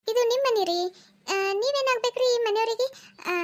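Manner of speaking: high, loud startled questioning; loud doorstep disbelief; taken aback. Acted. Acted doorstep questioning of stranger.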